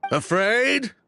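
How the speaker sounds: scoffing retort. Acted. Scoffing at suggestion of fear.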